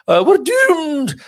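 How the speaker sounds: high, slow, hammed-up Scottish doom-monger; a gloomy Scotsman moaning in a Scottish accent. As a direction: wail it in a mock Scottish accent. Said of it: "with a Scottish accent".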